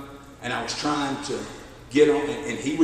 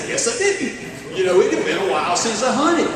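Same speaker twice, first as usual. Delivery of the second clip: laughing, high embarrassed admission; laughing at his own mistake; sheepish laugh; 8 semitones higher, 5 dB louder than usual. Real, not acted.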